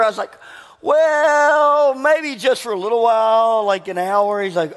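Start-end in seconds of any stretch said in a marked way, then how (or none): 0.8-4.4 sheepish admission. Retold or imitated. Admits being alone, sheepishly.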